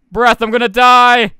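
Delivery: slow, high, loud cry of alarm; panicked yell. Real, not acted. Gamer running out of breath underwater, panicked yell. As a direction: stretch it out in a desperate shout.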